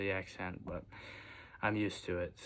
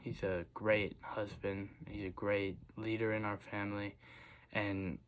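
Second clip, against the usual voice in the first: usual speaking voice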